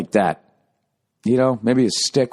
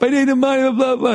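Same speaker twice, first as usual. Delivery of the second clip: pitched-up mock shouting; mock yell; 13 semitones higher, faster than usual. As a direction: yell over everyone in a high voice. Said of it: "he's yelling over the top".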